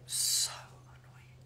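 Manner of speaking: breathy whispered sigh. Real, not acted. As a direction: whisper it, fed up. Lost voice, complaining.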